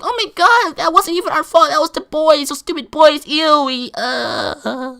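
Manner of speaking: high, loud mock-girl whine; complaining girls. Imitating girls blaming the boys for the ban, whiny outrage.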